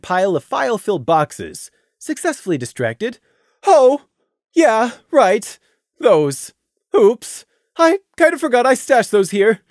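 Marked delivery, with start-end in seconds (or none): 3.7-9.6 louder, sheepish mare; sheepish Taffy. A narrator's reading. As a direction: caught out, laughing it off.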